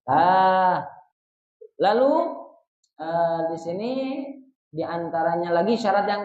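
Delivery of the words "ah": ordinary talk, nothing marked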